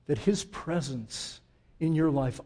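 ordinary talk, nothing marked

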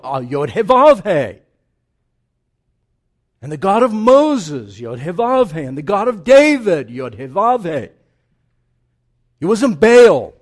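preacher hammering the holy name home; full-force preaching. Acted. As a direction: build it up like a revival sermon.

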